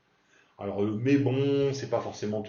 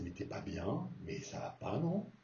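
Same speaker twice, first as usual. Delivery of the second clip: mild disbelief; 9 dB quieter than usual. Shown as a demonstration. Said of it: "Et c'est".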